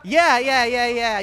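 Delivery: normal talking voice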